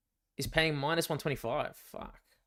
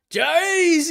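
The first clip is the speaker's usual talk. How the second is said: excited shout; 10 dB louder than usual. Real, not acted. Excited reaction to the arena chant.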